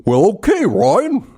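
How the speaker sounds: drawn-out dim moose reply; Bullwinkle voice. Retold or imitated. Saying yes to friend Ryan in a Bullwinkle voice. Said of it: "It was Bullwinkle J Moose".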